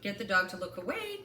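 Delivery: normal talking voice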